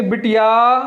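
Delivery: बिटिया called with the final आ held; calling out. Shown as a demonstration. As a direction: call to the daughter outside, drawing out the end. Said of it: "ऐसे क्या बुलाएंगे?".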